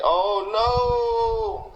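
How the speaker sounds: high, drawn-out mocking accent imitation; Ahmed the terrorist accent. Coworker mocking him in meetings whenever he gives an opinion, exaggerated character accent. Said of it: "in the same accent of that, uh, Ahmed the terrorist".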